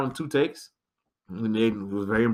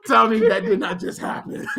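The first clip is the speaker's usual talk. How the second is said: laughing disbelief; 11 semitones higher than usual. Retold or imitated.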